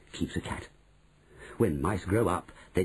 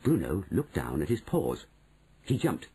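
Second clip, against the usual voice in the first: ordinary delivery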